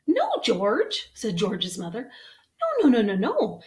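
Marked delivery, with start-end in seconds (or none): 0.1-1.1 high, loud motherly correction; dismayed mother. Acted. Mother dog correcting George, high dismayed voice. 2.6-3.6 rapid string of high, loud no's; dismayed mother. Acted. Mother dog correcting George, emphatic dismay.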